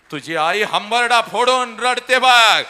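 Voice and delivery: slow, high, loud lament; the mother's loud lament to the father; wailing shout. Acted.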